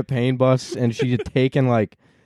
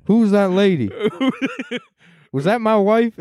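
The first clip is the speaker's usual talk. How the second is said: put-on confused old Biden; 9 semitones higher than usual. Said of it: "And he's like".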